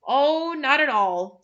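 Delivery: a flustered host's over-polite reassurance; forced polite smile. A narrator's reading. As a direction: smile through embarrassment. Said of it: "trying to smile".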